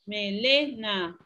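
slow "Melena" with a long, prominent LE; me-LE-na at a teaching pace; stressed syllable demo. Shown as a demonstration. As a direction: stretch out Melena to show LE as strongest. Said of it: "¿Cuál es la sílaba tónica?".

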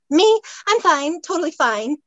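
high, loud start easing off, flustered; flustered babble. A narrator's reading. Flustered heroine insists to Theo she's fine, babbling. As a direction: blurt out an over-eager reassurance. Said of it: "she babbled".

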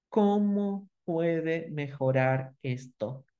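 each word laid out very slowly and deliberately; slow demo question. Shown as a demonstration. Teacher demonstrating the question very slowly to the workshop audience. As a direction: spell the question out slowly for the class. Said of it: "acá viene la herramienta de hacer una pregunta".

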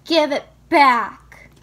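loud, slow angry demand; angry yell. Acted. Child demanding a taken toy back, angry shout. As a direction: shout it in heavy, spaced-out words. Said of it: "stomping your feet or growling".

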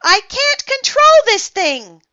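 high, loud panicked yell; alarmed shout. A narrator's reading. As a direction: cry out helplessly, out of control. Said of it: "he shouted".

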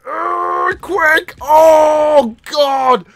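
high, loud pained yelps; panicked cries. Real, not acted. Rider with broken leg, panicked cries. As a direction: yelp in mock agony.

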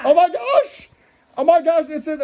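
very high, gushing mock surprise; excited astonishment. Host amazed by the next cartoon, high-pitched surprise. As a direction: gasp it out like an amazed fan.

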